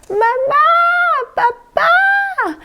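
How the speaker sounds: high, loud cry for mom and dad; child calling out. Acted. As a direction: shout like a frightened little girl. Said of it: "Iris comenzó a gritar".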